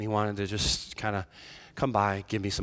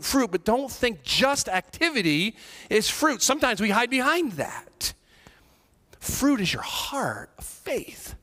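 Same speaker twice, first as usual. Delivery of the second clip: higher and louder, urgent sermon voice; fiery preaching; 9 semitones higher, 5 dB louder than usual. Acted. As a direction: drive the point home to the congregation. Preaches at full force.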